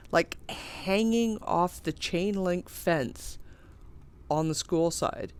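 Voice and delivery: usual speaking voice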